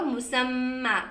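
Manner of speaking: मुसम्मा with a long final मा; pausal form. Shown as a demonstration. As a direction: stop on मुसम्मा, drawing out मा. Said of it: "आप रुक गए तो". (रुककर मुसम्मा, मा लंबा)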